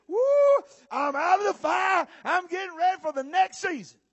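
high whoop into loud celebration; jubilant shout. Acted. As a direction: whoop and holler in triumph. Acted jubilation after the fire.

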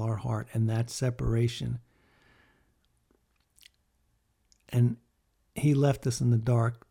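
preaching. Acted. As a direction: press the point home like a sermon. Preaching about death and God.

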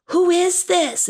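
slow, high call-and-repeat; a teacher modelling a line; say it to the kids. Shown as a demonstration. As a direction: lead the children in the line. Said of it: "Can you kids say that?".

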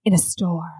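whispered ghost-story hush; hushed spooky whisper. Acted. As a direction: drop to a whisper for suspense.

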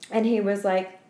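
ordinary delivery

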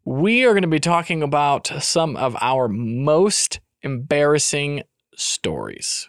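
slow, deliberate topic reveal; drawn-out announcement. Acted. Host tells listeners about the embarrassing stories, drawn out. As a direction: stretch each phrase for the big reveal.

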